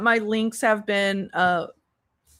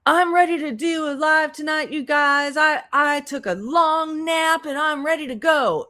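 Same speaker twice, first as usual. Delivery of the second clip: mocking her announcement; 6 semitones higher than usual. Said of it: "She's like".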